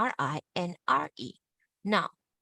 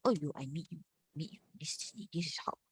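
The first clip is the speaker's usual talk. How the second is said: low, soft little role-play; mock meeting of tones; 3 semitones lower, 8 dB quieter, faster than usual. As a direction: murmur it as two low tones greeting. Acts two low tones meeting.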